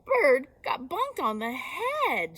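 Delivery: slow, loud, crying sheep; tearful report. Acted. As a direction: wail out the bad news.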